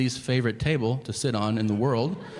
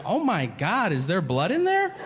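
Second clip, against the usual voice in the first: 1 horrified wife; 7 semitones higher than usual. Retold or imitated. Wife's horrified question.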